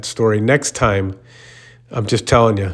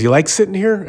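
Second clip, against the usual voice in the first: pitched-up sneering question; mocking gym guy; 10 semitones higher than usual. Gym regular sneering at the teller for sitting, high and mocking. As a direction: needle him, voice jumping high.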